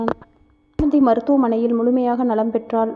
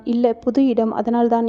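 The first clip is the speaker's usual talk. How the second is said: ordinary delivery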